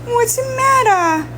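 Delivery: high cooing voice to a kitten; squeaky sing-song pet talk. Real, not acted. Baby talk to cat.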